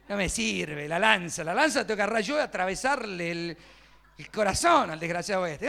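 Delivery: arrebato de enojo en voz fuerte; reviví la bronca, indignado y a todo volumen; recalentado. Retold or imitated. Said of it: "me recalenté". (enojo encendido, actuado)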